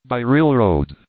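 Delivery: ordinary delivery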